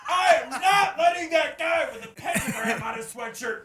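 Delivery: high, loud laughing impression; laughing shout. Laughing, imitating a wary customer.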